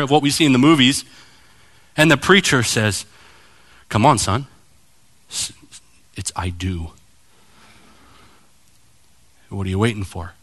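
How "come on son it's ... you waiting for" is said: low, hushed nudge; acted preacher's soft aside; discreet prompting. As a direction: quietly prompt someone stuck in front of a crowd. Preacher discreetly prompting hesitant groom.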